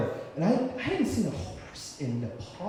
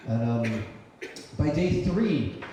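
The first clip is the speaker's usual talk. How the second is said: plain reading voice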